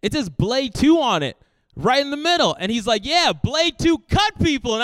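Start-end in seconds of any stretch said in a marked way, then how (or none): 0.0-2.6 higher, louder protest, imitated; mock-indignant, pointing out the obvious; animated retelling. Retold protest to family member. 3.0-4.7 high, loud and drawn-out retort; emphatic retort. Retold or imitated. Retold insistent reply.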